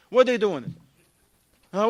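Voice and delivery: ordinary talk, nothing marked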